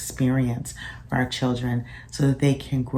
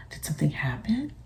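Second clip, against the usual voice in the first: gentle whisper; 3 semitones higher, 5 dB quieter, faster than usual. Retold or imitated. Mother gently asking upset son.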